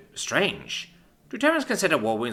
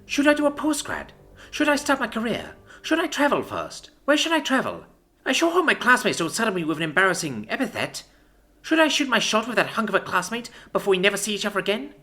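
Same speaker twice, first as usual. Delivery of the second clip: high, mock-pondering lilt; mocking mimicry; 11 semitones higher than usual. A narrator's reading. Commander mocks the life she lost, in a sing-song voice. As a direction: act out a carefree student, mockingly. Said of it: "in mocking mimicry of deliberation".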